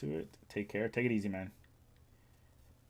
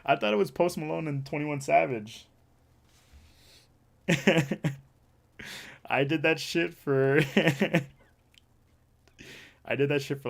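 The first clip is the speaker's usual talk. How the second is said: laughing; 2 semitones higher, 6 dB louder than usual. Real, not acted. Streamer recalling hours of mocking songs, laughing. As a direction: talk through bursts of laughter.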